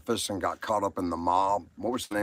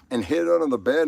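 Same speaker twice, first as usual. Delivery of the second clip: ordinary talk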